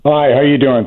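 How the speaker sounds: ordinary talk, nothing marked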